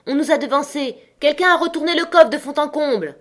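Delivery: high, urgent cry; alarmed shout. A narrator's reading. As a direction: shout the bad news down to the road. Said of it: "cria-t-il".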